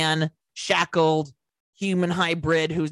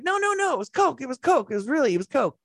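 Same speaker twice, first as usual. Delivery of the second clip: much higher, quick protesting insistence; a villain brushing off the question; insisting Army Hammer; 7 semitones higher than usual. Acted. Army insisting it was coke.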